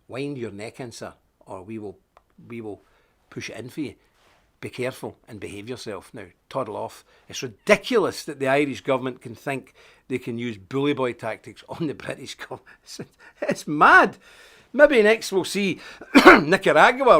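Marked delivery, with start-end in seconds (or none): 0.1-7.0 soft, menacing warning; quiet warning. Pretend. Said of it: "pull one of the Irish ministers aside and say". 12.7-14.2 laughing. Real, not acted. Laughs at the absurdity.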